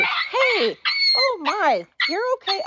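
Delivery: high-pitched startled call to a pet; real startled alarm. Real, not acted. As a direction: break off, startled, to check on the puppy. Puppy cries out during recording.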